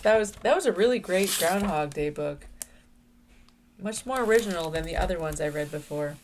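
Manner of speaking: ordinary delivery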